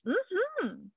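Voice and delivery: high falling "mm-hmm" of assent; a quick high hum of yes; gut yes hum. Retold or imitated. Host voicing a sacral gut yes, high hum. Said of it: "you might be like".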